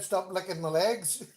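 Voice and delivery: a mild mock yell breaking into a laugh; laughing mock shout. Husband teasing wife about her shout, laughing. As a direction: tease her by echoing it. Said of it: "You just shouted out".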